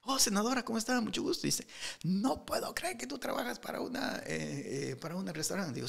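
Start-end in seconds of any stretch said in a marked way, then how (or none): 0.0-1.5 high, flustered polite hello; surprised greeting. Retold or imitated. 2.0-5.7 higher, softer disbelieving voice; a senator in mild disbelief; the senator amazed he works at a restaurant. Retold or imitated.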